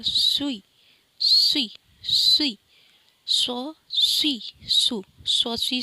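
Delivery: سوئی loud and pointed, the waw dropped; sui without waw. Shown as a demonstration. Teacher stressing سوئی without the waw. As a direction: snap سوئی out clean, no w. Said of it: "واو کے sound سے ہٹا کر".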